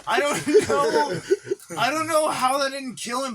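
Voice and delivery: loud, high, laughing disbelief. Real, not acted. Storyteller baffled the dog survived, laughing. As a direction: push the words out through real laughter.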